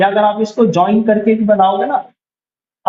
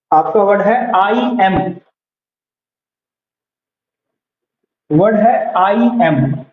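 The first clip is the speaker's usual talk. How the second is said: slow dictation; slower than usual. Shown as a demonstration. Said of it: "teacher dictating a word".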